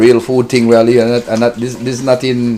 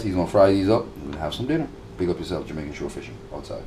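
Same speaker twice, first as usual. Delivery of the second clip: tired; 7 semitones lower, 10 dB quieter, faster than usual. Real, not acted. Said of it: "I'm tired".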